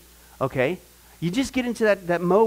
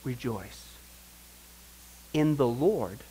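much softer, lower rejoice; hushed rejoice; 6 semitones lower, 5 dB quieter, slower than usual. Shown as a demonstration. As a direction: say it gently, almost to yourself. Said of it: "And he says".

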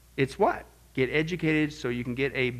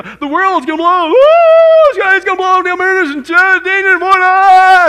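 loud, high, frantic alarm; hammed-up panic about the end of the world; mock panic; 16 dB louder than usual. Preacher mocking frantic Christians, screaming in mock panic. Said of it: "We go".

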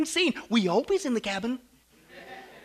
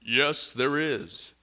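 low, unhurried voice from heaven; calm, powerful voice; 9 semitones lower, much slower than usual. Acted. Voice out of the sky answers the tourist, calm and powerful. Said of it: "this calm, powerful voice".

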